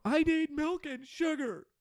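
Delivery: slow, very high put-on voice; drawn-out squeaky caricature of a customer; high-pitched passenger. Passenger demanding milk and sugar after all, high put-on voice.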